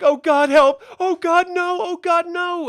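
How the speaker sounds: high, loud panicked screaming plea; terrified victim pleading. Retold or imitated. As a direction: shriek for help as the monster closes in. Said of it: "she's like".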